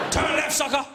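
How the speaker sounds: high, loud celebrity sat-nav bark; hammed-up tough-guy command; Mister T. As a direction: order the driver about forcefully. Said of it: "I've got Mister T on mine".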